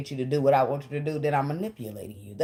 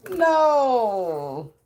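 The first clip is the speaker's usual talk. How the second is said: ordinary delivery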